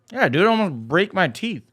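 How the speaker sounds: talking while chewing gum; words round a wad of gum. Real, not acted. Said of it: "I should not have put this gum in my mouth".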